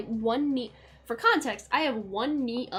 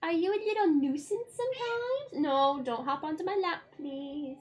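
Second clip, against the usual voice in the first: higher, sing-song cooing to the kitty; 10 semitones higher, slower than usual. Real, not acted. Streamer cooing at her cat by the window, baby talk.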